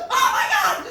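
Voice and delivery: very high shriek of horror, laughing; shrieking, laughing horror at the bloody egg; shocked shriek. Retold or imitated. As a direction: scream at the bloody yolk.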